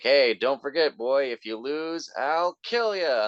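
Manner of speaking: high, loud, slow mock threat; Homer threat. Homer warns his son he'll kill him, slow and high.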